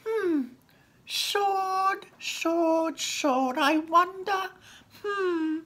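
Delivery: very high, musing goblin voice; thinking goblin. Acted. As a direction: muse aloud, high and drawn out. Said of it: "Grecklin was thinking".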